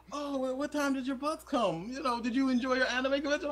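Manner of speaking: high, quick small talk; casual girl's chatter. Retold or imitated. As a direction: chat breezily like nothing's odd.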